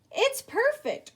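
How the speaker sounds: high, slow proud exclamation; beaming self-satisfied praise. Acted. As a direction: savor the success. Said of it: "Earl said proudly".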